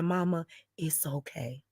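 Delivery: soft whispered comfort. Retold or imitated. Daughter consoling her mother, whispered.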